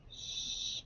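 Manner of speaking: "sh-" as a long voiceless hush; just the soft consonant of "sh-", no vowel; sounding out. Shown as a demonstration. Teacher sounding out the first letters of short, isolated sound. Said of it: "S H".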